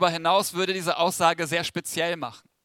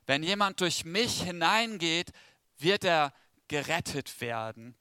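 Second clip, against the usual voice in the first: Schriftlesung; 2 semitones lower, 4 dB quieter than usual. Acted. Liest den Bibeltext vor.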